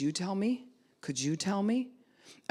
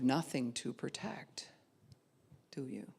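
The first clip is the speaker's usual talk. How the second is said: whispered rhetorical question; 3 semitones lower, 5 dB quieter than usual. Real, not acted. Preacher whispers to the congregation. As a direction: drop to a whisper to drive it home.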